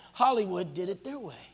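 ordinary talk, nothing marked

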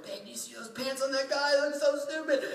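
high-pitched mock stage whisper, far too loud; failed whisper. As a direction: try to whisper and fail comically. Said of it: "you're so loud".

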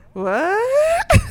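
high, loud, stunned "What?"; shocked what. Retold or imitated. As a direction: blurt it out in disbelief. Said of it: "I was just like".

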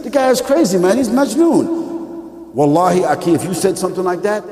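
ordinary delivery

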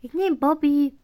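high, loud call; loud excited call. Real, not acted. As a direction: announce it with a loud shout. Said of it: "Ich nehm einen anderen Bobby".

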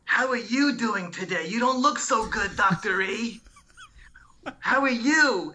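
high, concerned patient voice; Flavie's voice. Retold or imitated. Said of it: "She goes".

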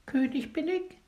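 small bird call. A narrator's reading. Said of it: "ruft er wohl zuweilen".

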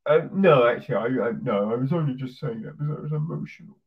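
rapid, mumbled backpedalling; flustered backpedal. Retold or imitated. Partner taking back the breakup threat, rapid and flustered. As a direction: gabble the excuse out fast. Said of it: "And then they're like".

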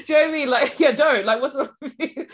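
laughing loudly through the words. Real, not acted. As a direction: talk while cracking up. Laughing at a friend's quote.

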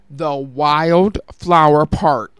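"Wildflower" drawn out very slow and loud, each syllable apart; said slowly. Real, not acted. Radio host slows down to finally get "Wildflower" right, said slow and loud. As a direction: spell out "Wildflower Park" slowly and firmly. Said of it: "So I have to just say it slow".

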